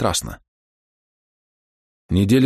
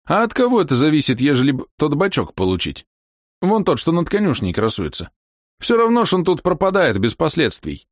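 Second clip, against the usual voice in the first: put-on raised, coaxing old man; 7 semitones higher than usual. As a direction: ask sweetly like a crafty old man. Voicing Kalina Ivanovich.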